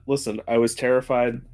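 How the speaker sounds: ordinary talk, nothing marked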